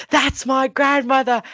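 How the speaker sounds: retold excited shout, high and drawn out; excited cry. Child spotting grandmother at work, excited cry.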